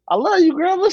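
high, sugary fake sweetness; butter her up, pitched high; sweet high voice. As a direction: grandson sweet-talking grandma, high voice. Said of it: "I play that".

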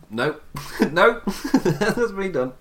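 high-pitched, laughing refusal; retold refusal. Host acting out a viewer walking out, mock refusal. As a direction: quit on the spot, high and amused. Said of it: "I went".

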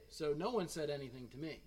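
ordinary talk, nothing marked

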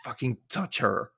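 stumbling through fear yet defiant; acted scared but determined stutter; fearful stammer. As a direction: force the words out haltingly. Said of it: "through, like, fear, she stumbles".

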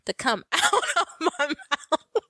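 words rising into a laugh; high, laugh-choked ending; breaking into laughter. Real, not acted. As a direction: crack up as you finish the line. Cracks up telling the story.

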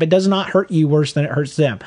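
normal talking voice

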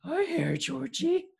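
very high, cartoonish clown voice; silly clown voice. Podcaster trying another clown voice on Georgie, high and silly. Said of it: "what if his voice was".

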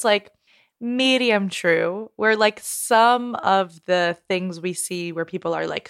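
ordinary talk, nothing marked

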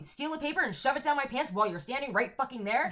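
ordinary delivery

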